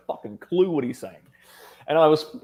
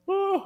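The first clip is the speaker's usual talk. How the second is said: very high mock moan of pleasure; mock ecstatic moan. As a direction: imitate her high, swooning "oh". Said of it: "losing her shit".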